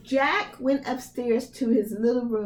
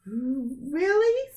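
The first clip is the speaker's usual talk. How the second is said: surprised Jack; 6 semitones higher than usual. Acted. Jack amazed.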